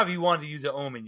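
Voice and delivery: normal talking voice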